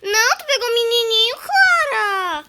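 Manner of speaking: high, loud outraged cry; shocked protest. Real, not acted. Friend caught the boy.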